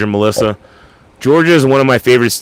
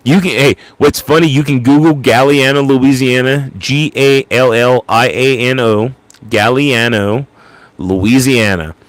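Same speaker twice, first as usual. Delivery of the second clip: usual speaking voice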